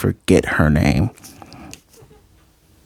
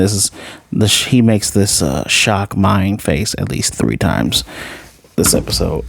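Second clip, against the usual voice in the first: normal talking voice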